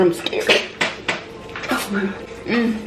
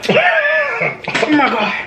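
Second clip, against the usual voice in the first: wailing; 11 semitones higher, much slower than usual. Real, not acted. Reacting to spicy food pain.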